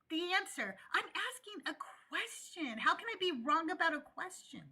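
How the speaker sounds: ordinary talk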